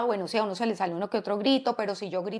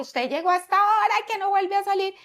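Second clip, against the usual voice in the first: a mock-angry parent scolding, higher and a bit louder; ogre parent; 8 semitones higher, 4 dB louder than usual. A parent scolds a teenager who came home late, mock angry. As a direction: play the ogre laying down the punishment. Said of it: "estoy yo como un ogro".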